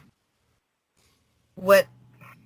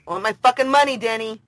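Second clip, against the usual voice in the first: louder, higher furious demand; angry outburst; 5 semitones higher, 6 dB louder than usual. Real, not acted. Ranting about the debt to a friend, furious. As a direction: shout the demand for the money.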